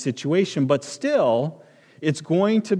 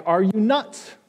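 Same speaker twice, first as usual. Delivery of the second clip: high, scoffing "Are you nuts?"; incredulous father-in-law; 9 semitones higher than usual. Retold or imitated. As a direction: mimic his disbelief, pitched up. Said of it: "He said it like".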